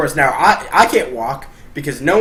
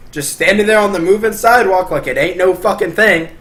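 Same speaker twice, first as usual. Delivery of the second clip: ordinary talk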